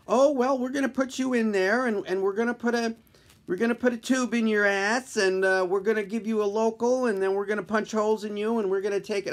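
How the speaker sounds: put-on young intern voice. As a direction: chirp it out like a cheerful kid doctor.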